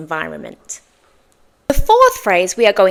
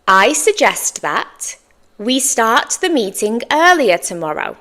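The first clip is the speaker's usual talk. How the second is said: slowed "I suggest that", pause, then the rest; careful model. Shown as a demonstration. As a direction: model it in two chunks for learners. Teacher's slower model after fluent take.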